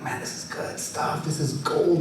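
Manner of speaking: hushed whisper to himself; private whisper. Retold or imitated. Grandson thinking to himself while filming, hushed. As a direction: breathe it like a secret thought.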